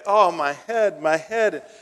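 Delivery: lightly voiced boy whining about his head; sick little boy. Sick boy complains to father.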